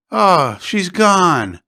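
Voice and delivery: drawn out in shock. Acted. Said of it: "It shocked him".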